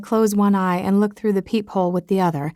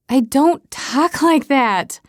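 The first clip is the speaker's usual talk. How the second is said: eye-rolling complaint; 5 semitones higher, much slower than usual. Acted. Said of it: "I complain with a roll of my eyes".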